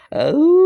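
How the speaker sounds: sudden high, loud wolf howl. Shown as a demonstration. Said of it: "let me make a sound of a wolf".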